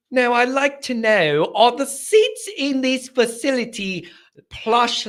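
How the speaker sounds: put-on lampoon a pampered rich fan in a made-up accent; hammed-up snobby made-up accent; mock posh accent. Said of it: "I don't know what accent that is".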